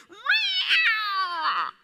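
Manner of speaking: high, loud kitten cry; comic high meow from a would-be lion; tiny meow. Acted. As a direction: squeak it like a cub trying to roar. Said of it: "he opens his mouth as a lion".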